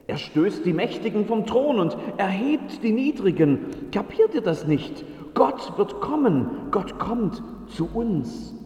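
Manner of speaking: höher, beschwörend erklärend; drängender Bote. Acted. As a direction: den Zweiflern eindringlich einhämmern.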